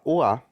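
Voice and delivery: O A run together as "wa"; French oi sound. Shown as a demonstration. Teacher explaining the vowel rule, French oi sound. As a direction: say O A as one glide. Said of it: "a gente vai falar".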